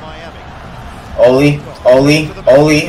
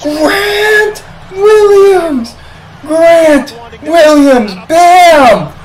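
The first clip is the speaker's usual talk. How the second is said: very high, stretched-out yell of the name; wild fan celebration at full pitch; ecstatic shouting; 4 dB louder, much slower than usual. Real, not acted. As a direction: scream the scorer's name, dragging each word. Celebrating Grant Williams' shot.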